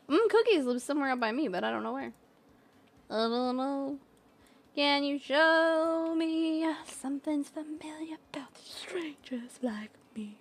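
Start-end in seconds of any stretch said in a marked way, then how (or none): 4.7-10.4 voiceless breathy whisper. Acted. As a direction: whisper the lyric softly. Whispered sung lyric.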